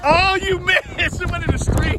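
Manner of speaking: real delighted high-pitched teasing; gleeful laughing taunt. Real, not acted. Daughter's snowball misses again.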